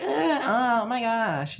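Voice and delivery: mock an annoying valley girl's gasp; high hammed-up valley girl squeal. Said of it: "they talk like this".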